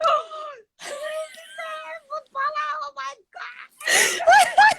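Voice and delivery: starstruck squeal. Retold or imitated. Realising a famous footballer is a fan, squealing starstruck. Her inner fan reaction retold.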